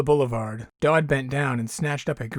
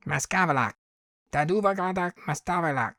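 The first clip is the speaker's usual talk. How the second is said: alien tongue; 4 semitones higher than usual. Acted. Alien speaks its own tongue.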